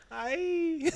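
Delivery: very high ay into laughter; high laughing ay. Retold or imitated. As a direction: squeak it then crack up. Laughing replay of crowd.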